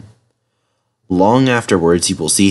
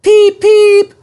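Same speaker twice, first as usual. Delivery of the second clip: very high, loud engine toot; piercing, gleeful two-note whistle; happy whistle; 7 dB louder than usual. A narrator's reading. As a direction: peep like a joyful train whistle. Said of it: "whistled Trevor happily".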